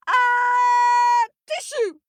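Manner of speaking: huge, high, loud sneeze; giant sneeze. Acted. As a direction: sneeze it out as words. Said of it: "The giant sneezed".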